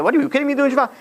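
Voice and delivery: high-pitched incredulous squabbling; mock fighting match. Wife fights husband over becoming religious, mock outraged yelling. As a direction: act out an outraged spouse, pitch jumping up. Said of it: "yeah, fighting match".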